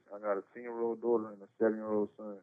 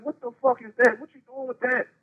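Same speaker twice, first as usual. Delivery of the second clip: alarmed; 12 semitones higher, 7 dB louder than usual. Retold or imitated. Cousin sees him pull gun.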